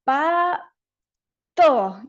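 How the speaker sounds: slow "pa" then "to" pushed out apart; a child forcing "pa... to" with effort; effortful syllables. Shown as a demonstration. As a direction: strain over each syllable. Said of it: "veamos como gestos de esfuerzo".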